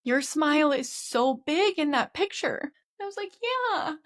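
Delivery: tears rising as she retells it; on the verge of tears. Real, not acted. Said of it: "Oh God, I am gonna cry".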